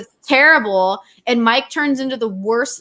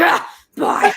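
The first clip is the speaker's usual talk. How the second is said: high, irritated goodbye; imitated irritation; 7 semitones higher than usual. Partner mid-bad-game brushing off her goodbye, irritated. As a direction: mimic a distracted, cranky gamer's bye. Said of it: "he was not having a good day".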